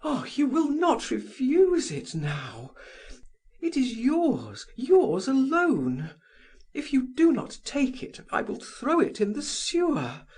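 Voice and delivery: agitated woman insisting, high pitched; excited. A narrator's reading. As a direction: force it on him, almost frantic. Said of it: "She became excited".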